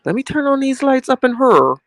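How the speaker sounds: imitating her own spooked self after the scary movie; retold fright. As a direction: replay the panic at the demon, a bit hammed-up. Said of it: "I said".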